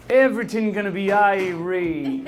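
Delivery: put-on slow, drawn-out Caribbean accent. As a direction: imitate an easygoing Caribbean reassurance. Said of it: "your best Caribbean accent".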